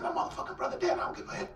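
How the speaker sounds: high, hoarse, unvoiced anguish; strained cry. Telling his sister his brother is dead, strained cry. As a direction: push it out through grief.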